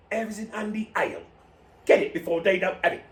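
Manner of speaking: lightly voiced slogan with a laugh; mock ad tagline. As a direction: tag the ad playfully. Ad tagline delivered.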